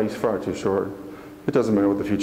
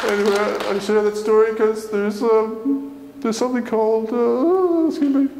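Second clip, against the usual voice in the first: high, cracking voice; trying to speak through tears; choked up; 13 semitones higher, 4 dB louder, slower than usual. Real, not acted. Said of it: "When I get choked up, I can't talk".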